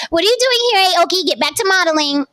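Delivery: high, brushing-off mom voice; mimicking a dismissive mother. Mimics mother waving daughter back to modeling, high and dismissive. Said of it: "Literally verbatim, that is what she told her daughter".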